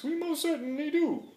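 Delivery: high, piping little voice; piped tiny voice. A narrator's reading. The mayor answering Horton from the speck, high and piping. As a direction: pipe it up like a tiny Who. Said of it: "piped the voice".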